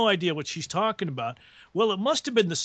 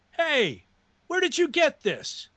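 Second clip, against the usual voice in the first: higher-pitched mock accusation; indignant demand; 9 semitones higher than usual. Waitress to old man at table, imitated indignant demand. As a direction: confront someone, lightly acted in a raised pitch. Retold waitress confronts an older customer.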